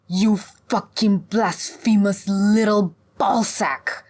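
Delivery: slow, loud, spitting insult; venomous. A narrator's reading. Hag curses the blasphemous young hunter, venomous. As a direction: spit each word in outrage. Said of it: "the hag spat venomously".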